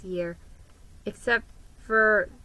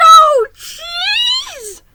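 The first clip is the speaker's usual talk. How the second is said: shrieked, far higher and louder, in panic; horrified cry; 13 dB louder than usual. A narrator's reading. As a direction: wail it like the world is ending. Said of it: "he gasped in horror".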